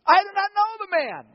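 high, loud insistent denial; Peter's angry denial. Acted. Peter denying Jesus under oath, loud and high. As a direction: swear it out loud to the crowd.

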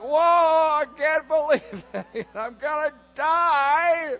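hammed-up terrified shepherd with a laugh; freaking out. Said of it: "Because they're freaking out".

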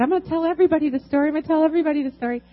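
high, fast, tumbling with joy; overjoyed rush. Retold or imitated. Single mom promising Jesus she'll tell everyone, overjoyed and fast. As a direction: blurt it out, bursting with gratitude.

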